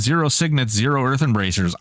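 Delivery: ordinary talk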